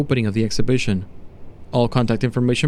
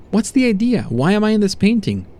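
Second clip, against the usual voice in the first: higher-pitched, indignant questioning; alarmed test subject; 8 semitones higher than usual. Acted. Subject to doctor on seeing himself painted, alarmed. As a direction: demand an explanation, voice jumping up.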